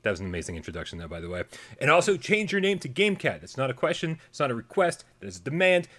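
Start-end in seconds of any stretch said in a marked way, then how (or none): 1.8-5.9 mock demanding.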